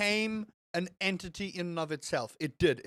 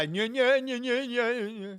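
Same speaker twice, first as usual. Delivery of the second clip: higher, whining nyeh-nyeh mockery; mock nitpicker; 7 semitones higher, 5 dB louder than usual. Streamer imitating that guy he refuses to be, nitpicking. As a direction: parody a pedant nagging on and on in gibberish. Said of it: "I don't wanna be that guy".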